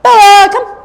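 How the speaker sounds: loud, high recall call; calling the dog. Real, not acted. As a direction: call her to come.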